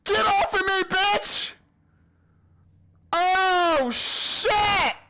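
high frantic shouting; panicked shouting. Real, not acted. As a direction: shout in panic to break free. Monster holding him.

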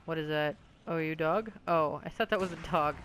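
ordinary talk

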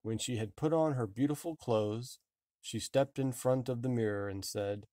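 ordinary delivery

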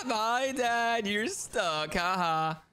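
teasing laugh through the words; high giggle settling into a gloating ha-ha; laughing at dad. Pretend. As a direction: crow with laughter at the stuck seeker. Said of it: "you're laughing at me?".

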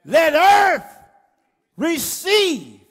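loud, rising sermon cry; full-force preaching. Acted. Preacher stirring the congregation, loud and fierce. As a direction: preach it hard to the crowd. Preaching climax, shouted lyrics.

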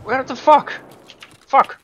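loud high frustrated swearing; frustrated shout. Real, not acted. Frustrated after teammate dies.

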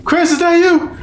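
high eerie call; mock villain line. Friend quoting the villain's line, high pitched. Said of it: "he was like".

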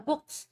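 "books" ending in a voiceless S; final S sound. Shown as a demonstration. Teacher models the ending for students, final S sound. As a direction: finish "books" on a crisp s, not z. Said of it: "mình chỉ có books là S thôi".

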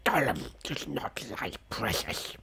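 whispered, rasping Gollum voice; Gollum impression. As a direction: hiss it like Gollum clutching the ring. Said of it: "That was a good impre".

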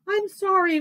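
high, loud sorry whine; pleading high voice; whining cub. A narrator's reading. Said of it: "whined Wolf".

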